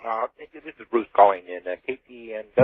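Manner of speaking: faint, distant phone line; over the phone. Real, not acted. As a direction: caller barely audible on the phone. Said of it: "Bruce, I can barely hear you".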